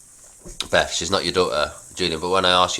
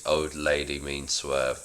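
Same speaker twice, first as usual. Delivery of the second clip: slower, softer echo of the line; slow soft repeat; 2 semitones lower, 5 dB quieter, slower than usual. Acted. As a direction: repeat it back slowly, half under the breath. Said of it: "Julian repeats".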